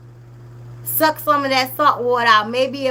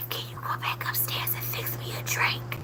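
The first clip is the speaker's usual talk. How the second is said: breathy voiceless whisper, much softer; mocking whisper; 13 dB quieter than usual. Imitating the mom whispering to her daughter, hushed. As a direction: whisper it like a secret request. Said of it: "this what you whisper her".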